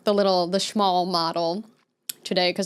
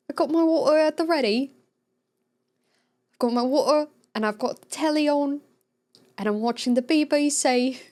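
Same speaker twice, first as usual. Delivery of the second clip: hammed-up British accent, higher; 6 semitones higher than usual. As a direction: put on a cheerful British accent. Telly, BBC in put-on voice.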